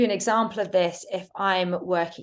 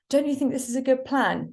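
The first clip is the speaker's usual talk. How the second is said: rising, unsure asking; tentative question; 7 semitones higher than usual. Shown as a demonstration. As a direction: ask it hesitantly, rising high. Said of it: "rather than saying something like".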